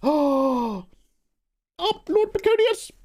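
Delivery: very high squeal of delight; excited shout-out. Acted. Streamer greets Lord Baconius's subs, very high excited squeal. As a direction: squeal the name in glee.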